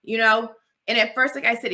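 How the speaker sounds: usual speaking voice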